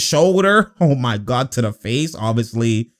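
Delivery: usual speaking voice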